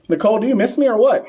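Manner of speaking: high, loud, fast teasing. Real, not acted. Streamer teases a viewer who misses him, playfully loud. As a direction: needle her playfully.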